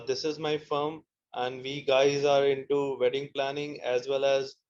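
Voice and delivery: ordinary talk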